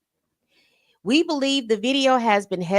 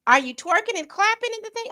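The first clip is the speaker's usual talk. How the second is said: much higher, astonished repeating; high incredulous disbelief; 10 semitones higher than usual. Real, not acted. Mocking the cheek price, high shocked disbelief. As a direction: squeak out the figure in disbelief.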